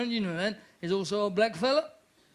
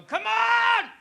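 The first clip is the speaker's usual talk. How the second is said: much higher, loud cheering shout; roaring gambler shout; roulette shout; 14 semitones higher, 5 dB louder, slower than usual. Retold or imitated. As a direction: yell at the wheel for luck. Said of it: "I go".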